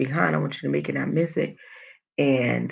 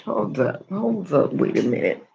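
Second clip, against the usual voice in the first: ordinary delivery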